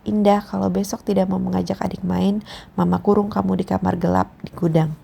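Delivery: plain reading voice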